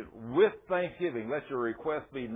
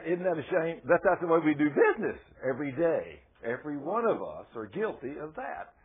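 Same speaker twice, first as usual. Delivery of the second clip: ordinary delivery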